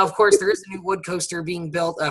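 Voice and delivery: normal talking voice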